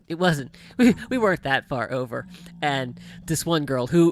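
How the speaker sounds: ordinary talk, nothing marked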